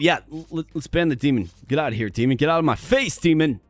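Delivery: normal talking voice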